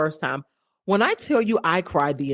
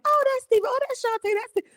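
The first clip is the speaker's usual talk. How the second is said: much higher, sing-song fond voice; high affectionate gushing; high cooing; faster than usual. Retold or imitated. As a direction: coo their names up high like doting family. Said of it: "you're kinda like".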